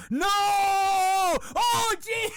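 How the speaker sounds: high, loud mock-horrified cry; horrified disbelief. Bus passenger hears about the snooker table, mock horrified. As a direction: wail in exaggerated shock.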